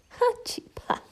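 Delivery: high breathless chirping laugh; cheeping laugh. Acted. Bird laughing at Shelley, high chirping laugh.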